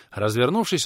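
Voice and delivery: usual speaking voice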